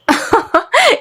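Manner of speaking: high-pitched female cackle; acted scornful giggle; mocking laugh. Mocking laugh at failed student.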